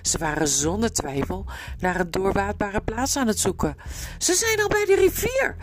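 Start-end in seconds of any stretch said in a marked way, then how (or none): 4.2-5.6 very high, alarmed cry; wild shout. A narrator's reading. Shasta warns they are at the river, wild shout. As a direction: cry out a frantic warning, pitch way up. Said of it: "schreeuwde hij wild".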